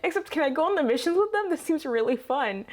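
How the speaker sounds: lightly voiced eager boy; eager kid voice. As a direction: play him as a thrilled child volunteering. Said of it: "he's just like".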